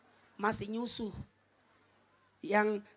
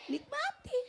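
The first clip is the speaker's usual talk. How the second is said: much higher squeaky voice; playful squeal; 4 dB quieter than usual. Acted.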